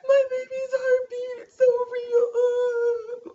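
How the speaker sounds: half-sung, weepy happy crying; ugly crying. Retold or imitated. Showing viewers how she cried at the heartbeat, high weepy sobbing. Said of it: "I was in there like this".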